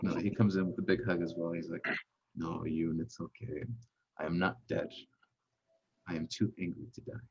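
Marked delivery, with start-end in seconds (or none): 2.3-7.2 quiet, lightly voiced weakened construct; low deep voice. Rubbish reassures Yoon he lives, low quiet voice. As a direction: pitch it low and soft. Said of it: "coming from a low, deep voice".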